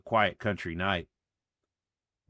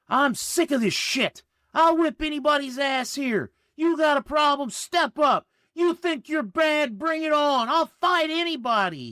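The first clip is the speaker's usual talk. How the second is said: put-on high drunken screaming rant; 5 dB louder than usual. A narrator's reading. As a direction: scream it, out of control. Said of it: "he screamed".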